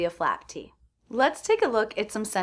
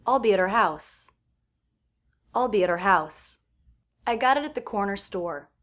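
ordinary talk

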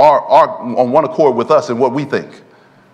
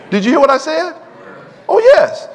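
high excited challenge; 13 semitones higher than usual. Real, not acted. Preacher's high challenge to congregation.

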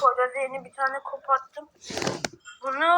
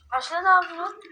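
ordinary talk

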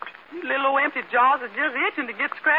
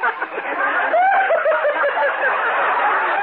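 laughing; 5 dB louder than usual. Acted. Amused by the circle joke.

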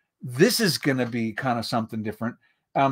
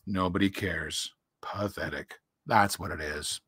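low, soft gloomy donkey; gloomy Eeyore; 7 semitones lower, 8 dB quieter than usual. A narrator's reading. Gray old donkey looks at himself in the water, gloomy. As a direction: sigh it out as a dejected, low-voiced donkey. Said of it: "said Eeyore gloomily".

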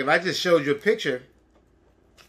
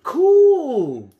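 much higher, loud thrilled cool; excited cool; 10 semitones higher, 9 dB louder than usual. Real, not acted. Reacting to picture for child.

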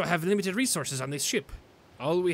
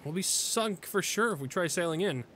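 normal talking voice

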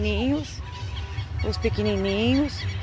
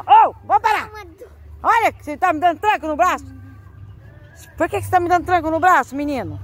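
exasperated scolding; 8 semitones higher, 5 dB louder than usual. Real, not acted. Dog yanking her arm.

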